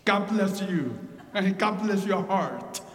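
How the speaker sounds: ordinary talk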